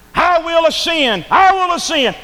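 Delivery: high, loud, slow proud cry; proud Lucifer. Acted. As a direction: lift it like a vain rebel. Lucifer boasting in sermon.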